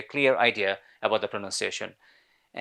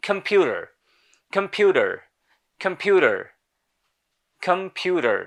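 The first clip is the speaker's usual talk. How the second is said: American "কম্পিউটার", higher, tapped d-like t and r ending; American pronunciation; 5 semitones higher than usual. Shown as a demonstration. Teacher drills the word for learners, American accent. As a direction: model an American accent with a sounded final r. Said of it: "in American English it is pronounced as".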